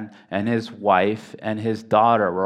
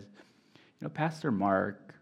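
gentle reply; 8 dB quieter, slower than usual. Retold or imitated. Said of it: "looks at me and smiles and says".

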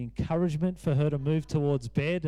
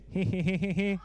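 imitated run of quick giggles; mock giggle. Father imitates his daughter popping up from fake tears, mock giggle. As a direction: mimic a child suddenly giggling. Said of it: "her head pops up and she goes".

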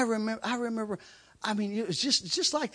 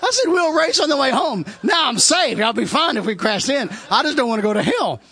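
pitched up and loud, laughing; high loud laughing; 5 semitones higher, 9 dB louder than usual. Real, not acted. Preacher laughing at his younger self, high and loud. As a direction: cackle the line out, high and loud.

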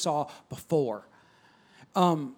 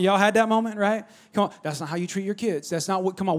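normal talking voice

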